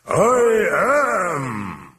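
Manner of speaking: high, loud monstrous roar; booming demonic bellow. A narrator's reading. Demon voice crackling off the walls, terrifying bellow. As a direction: boom it out, long and terrifying. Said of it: "terrifying voice boomed".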